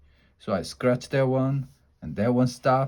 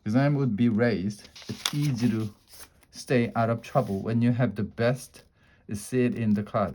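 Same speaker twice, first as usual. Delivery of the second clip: ordinary delivery, nothing marked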